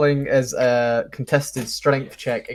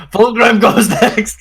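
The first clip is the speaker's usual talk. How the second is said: loud, higher, drawn-out proclamation; triumphant announcement; 9 semitones higher, 9 dB louder, slower than usual. Pretend. Player announcing their own character's turn, triumphant proclamation. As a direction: crow it out slowly and loudly.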